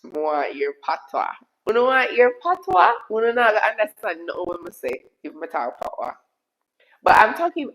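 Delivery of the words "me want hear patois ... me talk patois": animated Jamaican patois, rising into a challenge. Shown as a demonstration. Streamer telling viewers they won't understand, in Jamaican patois. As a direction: answer back in thick Jamaican patois. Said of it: "I just spoke patois though".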